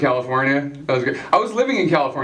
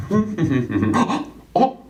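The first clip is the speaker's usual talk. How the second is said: Sean Connery voice; 3 semitones lower, slower than usual. Acted. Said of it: "He'd be just like".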